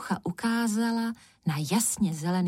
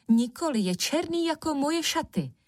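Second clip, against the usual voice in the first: higher, insistent contradiction; defending her opinion; 8 semitones higher than usual. A narrator's reading. Černucha defending her colour to her sisters, insistent. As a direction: argue back stubbornly. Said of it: "hájila svůj názor Černucha".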